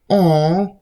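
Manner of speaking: high, mocking coo as if soothing a baby. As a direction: coo at them like a pampered infant, sarcastic. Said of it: "no one's gonna sit there and be like".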